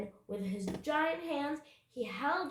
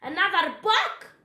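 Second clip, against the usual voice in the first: high, loud pirate bellow; explosive captain's question; outraged roar; 8 semitones higher, 11 dB louder than usual. A narrator's reading. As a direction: roar in disbelief. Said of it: "roared Captain Bones".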